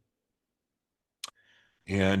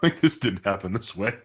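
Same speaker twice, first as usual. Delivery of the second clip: laughing. Retold or imitated. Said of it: "I'm laughing hysterically".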